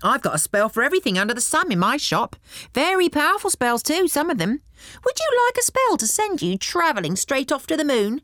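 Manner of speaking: high, fast, bragging goblin salesman; boastful goblin. Acted. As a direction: rattle it off like a proud goblin shopkeeper. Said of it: "asked the goblin".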